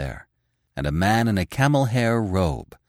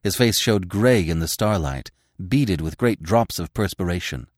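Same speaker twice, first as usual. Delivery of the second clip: ordinary delivery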